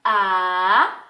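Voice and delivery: "a" loud and held long on its own; isolated syllable. Shown as a demonstration. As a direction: sound out "a" as a separate, stretched syllable. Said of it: "pronunciar a palavra com bastante atenção".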